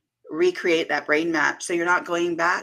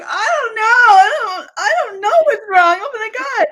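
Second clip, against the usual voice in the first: high, louder imitated crying; tearful confusion; 9 dB louder than usual. Guest reenacting herself crying to her husband, tearful and high. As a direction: replay yourself breaking down, crying through the words. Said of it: "And I'm like".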